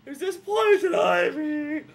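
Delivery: put-on high, loud, slow child's call; a high, drawn-out childish shout to his dad; innocent kid voice. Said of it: "And he goes".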